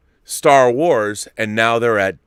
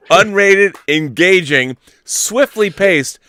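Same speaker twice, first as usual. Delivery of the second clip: rising, louder trailer-style hype; hype build; 6 semitones higher, 8 dB louder than usual. Real, not acted. Host hyping his show to the chat, building hype. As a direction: build it up to the big finish.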